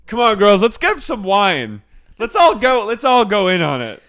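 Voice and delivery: high, loud rallying call; drunk Miranda. Retold or imitated. Miranda rallying the girls to chip in, loud tipsy voice. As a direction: cheer the girls on like a tipsy ringleader. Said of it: "She goes".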